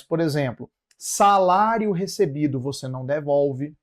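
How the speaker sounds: ordinary talk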